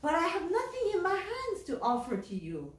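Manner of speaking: high-pitched, baffled objection; acted astonished protest; bewildered protest. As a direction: object in comic disbelief. Puzzled Sangoro objects to the minister.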